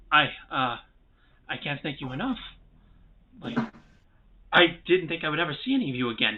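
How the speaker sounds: relieved dwarf, lightly acted; mildly overwhelmed gratitude. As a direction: stammer thanks in lingering disbelief. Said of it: "is very shocked to see".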